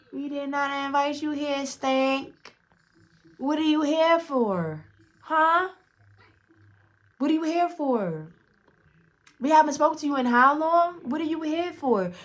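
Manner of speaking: high-pitched acted indignation, drawn-out questions; indignant confrontation. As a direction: act out putting someone in their place. Retold confrontation with an unwanted guest.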